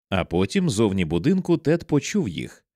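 plain reading voice

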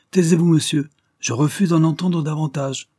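plus fort et bien plus aigu, il coupe court; ordre furieux. Acted. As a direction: une colère qui claque. Le squire coupe l'accusé. (ordre sec et furieux)

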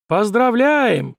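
loud, slow, drawn-out announcement; booming. Acted. Organiser congratulating the winner of the pole climb, booming. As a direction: boom it out high and stretched. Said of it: "прогудел представитель организаторов".

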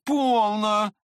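high, loud cry to stop; Trishatov's shout. A narrator's reading. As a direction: call out to rein in a friend. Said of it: "крикнул ему Тришатов".

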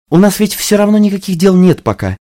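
high whiny pleading voice; begging. Acted. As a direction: coax her like a pleading child. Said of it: "умоляющим тоном клянчила Мелодия".

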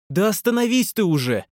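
offended squeal. Acted. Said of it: "обиженно визгнула девчонка".

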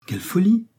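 high-pitched cry of dismay; shocked exclamation. A narrator's reading. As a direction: pitch jumping up in disbelief. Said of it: "s'écria-t-elle".

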